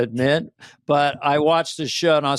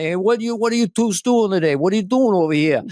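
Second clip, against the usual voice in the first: put-on higher-pitched New York tough-guy accent; East Coast mobster accent; 6 semitones higher, 4 dB louder, faster than usual. Bronx local greeting visiting Irish relatives, New York accent. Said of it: "the East Coast accent, all those mafia films".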